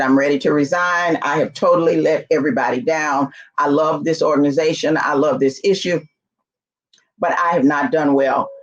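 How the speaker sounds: ordinary talk, nothing marked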